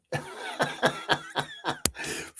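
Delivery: breathless high-pitched giggle; a squeaky burst of laughter. Real, not acted. Giggling at a viewer's joke on the stream, high and airy.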